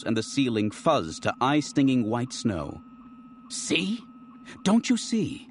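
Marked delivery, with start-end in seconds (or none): 3.5-5.5 much higher, desperate pressing; frantic pleading. Acted. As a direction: keep the panicked high pitch.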